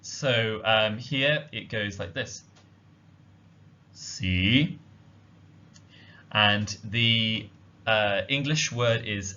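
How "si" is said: "si" starting at the lowest point, then rising, Cantonese tone five. Shown as a demonstration. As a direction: dip low on "si" and rise. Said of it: "starts at the lowest possible point and then rises upwards".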